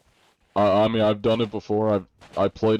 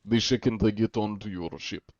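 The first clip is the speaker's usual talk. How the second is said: put-on thick Russian accent from the back of the throat; 2 semitones higher than usual. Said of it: "stop with the Russian accent".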